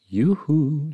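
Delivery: high, loud sing-out; playful yell. Acted. Orangutan hails a leopard across the pond, playful yell. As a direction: holler to get someone's attention. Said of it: "she yelled".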